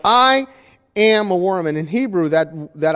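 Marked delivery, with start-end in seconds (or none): 0.1-1.7 "I" high and loud, then a pause before "am a worm"; emphatic I. Retold or imitated. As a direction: punch "I" hard, raised, and let it hang. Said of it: "is very emphatic".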